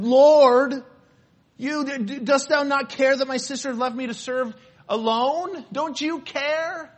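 imitating an exasperated sister; frustrated Martha. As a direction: complain to the Lord, fed up. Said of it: "she's frustrated".

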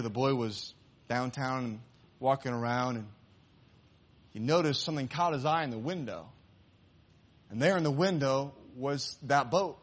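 usual speaking voice

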